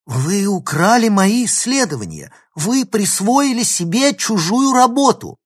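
high, loud outraged accusation; angry shouting. A narrator's reading. Girl accuses professor of stealing her research, shouting. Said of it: "Незачем так кричать".

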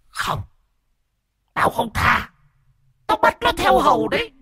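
very high, eerie possessed voice; ghostly refusal. A narrator's reading. Ghost in the boy refuses grandmother, high and eerie. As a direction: refuse her like a cruel spirit.

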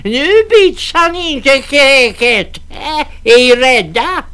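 high, loud mocking old woman; defiant crone. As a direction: taunt them as cowards. Crone defies the men.

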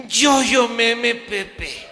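high, loud stuttering tú tú; too shocked to form words; choking on panic; panicked stammer. Retold or imitated.

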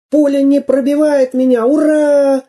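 high-pitched triumphant cheer; jubilant cry. A narrator's reading. Самоделкин finds bullets bounce off him, jubilant. Hero rejoices at being bulletproof.

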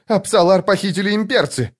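urgent high-pitched cry, little added volume. A narrator's reading. Youth brings friends news of a kidnapping, crying out. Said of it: "закричал он".

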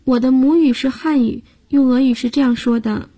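ordinary talk, nothing marked

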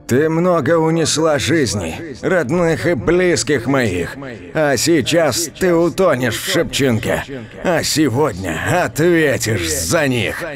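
high, stern voice of judgement; vengeful accuser. Acted. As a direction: pronounce her sentence at her drowning. Avenger condemns witch at river.

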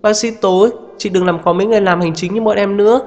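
high, pleading, close to crying; near tears. Acted. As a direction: beg on the verge of tears. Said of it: "cô thư ký như sắp khóc tới nơi".

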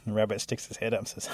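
ordinary delivery, nothing marked

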